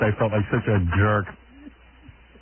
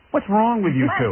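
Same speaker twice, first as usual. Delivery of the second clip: higher scolding mother voice; imitating mother; 7 semitones higher than usual. Daughter imitating her mother scolding on the tape. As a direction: mimic a nagging mom. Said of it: "And you hear my mother?".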